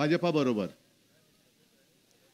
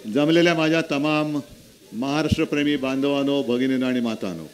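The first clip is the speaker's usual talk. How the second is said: louder, lower rally greeting; rally speech; 4 semitones lower, 4 dB louder than usual. Acted. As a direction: address the crowd from the stage.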